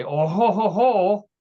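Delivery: high, loud mock-French chortle; hammed-up French "oh ho ho"; French accent. Host announces the judges' verdict, hammed-up French accent. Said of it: "do my best French accent".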